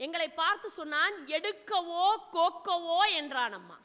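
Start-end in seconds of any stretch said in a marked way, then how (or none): 1.4-3.0 drawn-out, measured words of a king; calm, deliberate royal challenge; slow weighty line. Retold or imitated. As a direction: let each word land slowly, like a riddle. Duryodhana's calm reply, retold.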